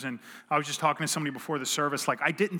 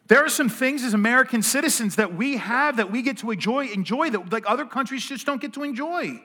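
higher, louder preaching push, building on freedom; rising preaching; 5 semitones higher, 4 dB louder than usual. Acted. Pastor rallying the congregation about freedom, fervent preaching. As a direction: preach it out to the room with growing force.

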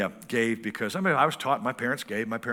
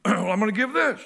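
much higher, louder goofy buddy; hammed-up drinking buddy in church; beer-loving buddy; 5 dB louder than usual. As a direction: joke about beer like a rowdy friend.

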